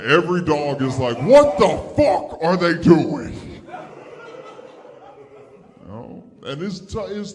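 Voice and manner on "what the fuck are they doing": loud, high British voice of an outraged dog; British accent. Acted. A dog on Fourth of July at fireworks, British accent. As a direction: cry out in a put-on British accent. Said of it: "every dog is British on Fourth of July".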